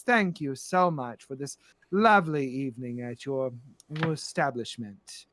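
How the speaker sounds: low, measured grande dame; gracious, sinister madam. Acted. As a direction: give thanks with cool condescension. Plays Madam Storybrooke.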